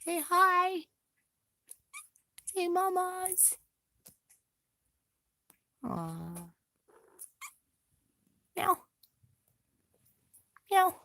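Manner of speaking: put-on baby voice for the cat; high pet talk. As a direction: urge the kitten to talk.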